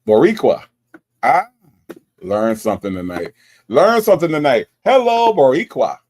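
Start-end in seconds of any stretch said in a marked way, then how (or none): 0.1-0.6 "Boricua" said loud as bo-REE-kwa; confident pronunciation. Shown as a demonstration. Streamer has got the name, confident loud "Boricua". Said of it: "I got it". 3.7-6.0 loud, higher-pitched celebratory greeting; triumphant. Real, not acted. Streamer greeting the viewer after learning the name, loud and triumphant.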